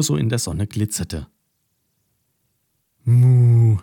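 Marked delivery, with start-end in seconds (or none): 3.0-3.8 loud, drawn-out cow moo; baffled moo. A narrator's reading. The cow Carola alone at the pool, a loud baffled moo. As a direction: moo in disbelief. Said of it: "schüttelte verständnislos den Kopf".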